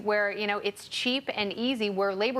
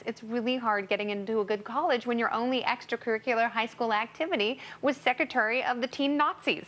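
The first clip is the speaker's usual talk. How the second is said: ordinary talk, nothing marked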